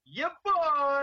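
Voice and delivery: high, loud, drawn-out cheer; excited whoop. Real, not acted. Panelist hyped to be called back on the clock, excited whoop. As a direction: whoop it out like a hype man.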